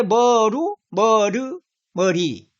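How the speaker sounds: "머루, 머르, 머리" one by one, first syllables held; pronunciation demo. Shown as a demonstration. Teacher recapping the sound shift, step-by-step pronunciation demo. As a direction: step from "루" through "르" to "리". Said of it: "발음할 수 있죠".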